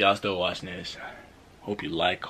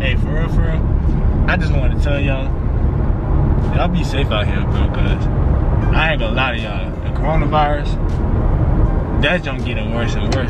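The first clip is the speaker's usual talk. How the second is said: loud heartfelt message; 3 semitones higher, 11 dB louder than usual. Real, not acted.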